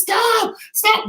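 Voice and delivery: very high mock female shriek; woman screaming stop. Imitating the woman begging them to stop, high shriek. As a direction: squeal it like a panicked woman. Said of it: "and she was".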